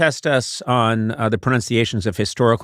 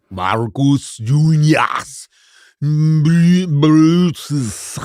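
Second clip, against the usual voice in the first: drawn-out, loud, higher mock Latin; stupidest classical Latin; 4 semitones higher, 4 dB louder, much slower than usual. Contestant attempting classical Latin as stupidly as possible, overblown mock-Latin. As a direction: stretch each name into absurdly overblown Latin. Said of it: "did it the stupidest way I could".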